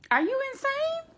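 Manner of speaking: high, sharp squeak of disbelief; shrill-high are you insane; incredulous. Real, not acted. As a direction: throw the question up in total disbelief. Outraged at her staying up.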